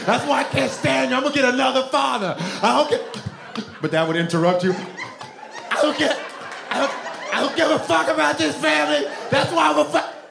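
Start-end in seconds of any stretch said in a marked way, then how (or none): 0.0-3.2 put-on high, frantic kid ranting; mock childhood scream. Kid screaming at his family after a beating, high mock rant. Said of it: "I would scream". 4.7-10.2 put-on high, frantic kid ranting; mock childhood scream. Kid screaming at his family after a beating, high mock rant. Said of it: "I would scream".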